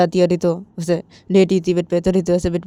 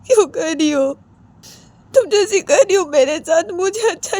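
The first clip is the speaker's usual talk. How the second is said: high crying voice, choked start; hurt breakdown, whining and high; tearful pleading; 6 semitones higher than usual. Acted. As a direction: plead through drawn-out sobbing words.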